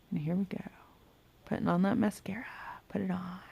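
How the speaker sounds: quick whispered self-talk; hushed focused murmur. Real, not acted. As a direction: whisper along while applying mascara. Whispering during mascara.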